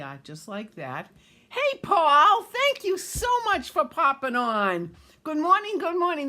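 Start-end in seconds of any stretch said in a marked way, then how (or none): 1.5-6.3 much higher, louder, delighted hello to Paul; excited greeting. Real, not acted. Host greeting her nephew joining the stream, high and loud. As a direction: call out a thrilled welcome.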